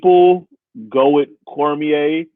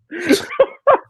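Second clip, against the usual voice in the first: high laugh. Real, not acted. Laughing after quote.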